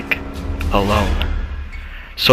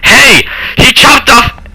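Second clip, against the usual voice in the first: very high, loud cry of alarm; panicked yell; 7 dB louder, slower than usual. Acted. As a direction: yell in shock to get help. Child cries out to employee.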